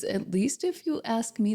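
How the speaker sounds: ordinary delivery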